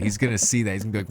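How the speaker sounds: ordinary talk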